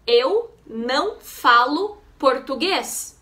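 teacher's slow model, pausing between words; slowly, with clear gaps between words. Shown as a demonstration. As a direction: say each Portuguese word separately for learners. Teacher models phrase slowly word by word.

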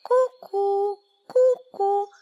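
high, loud cuckoo call. A narrator's reading. The cuckoo calls out her own name, high. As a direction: coo it like a cuckoo clock. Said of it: "zakukała".

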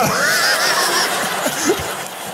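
high breathy burst of laughter. Real, not acted. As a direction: crack up at your own line. Laughing at own joke.

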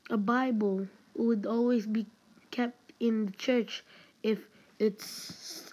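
ordinary talk

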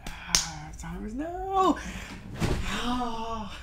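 slow, high and loud; overwhelmed cry. Real, not acted. Sharing the big moment with partner, a slow high cry. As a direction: let it burst out. Climax of emotional call.

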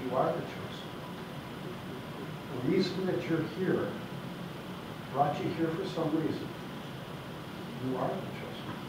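hushed, grave send-off; solemn hush. Real, not acted. Solemn closing echo of ninja line.

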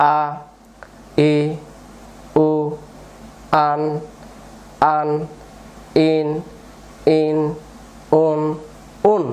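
each syllable separate, with a pause after it; broken-up drill reading. Shown as a demonstration. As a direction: read out one sound at a time for learners to follow. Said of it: "ভেঙে ভেঙে পড়বো". (ভেঙে ভেঙে, থেমে থেমে উচ্চারণ)

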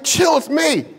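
high-pitched acted shout; calling out. Jesus hails the frightened disciples in the boat, calling out. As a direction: call across to calm them down.

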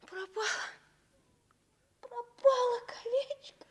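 rising wail over the lost ring; a child lamenting a lost ring. Acted. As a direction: let it climb into a plaintive cry.

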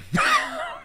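high burst of laughter. Real, not acted. Gamer amused by his own crude joke, laughing.

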